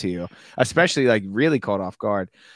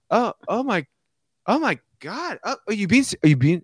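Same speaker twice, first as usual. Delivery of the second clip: much higher, astonished; stunned; 10 semitones higher, 3 dB louder than usual. Retold or imitated. Storyteller reliving the shock, high and stunned. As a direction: gasp it out in disbelief. Stunned self in retold prank.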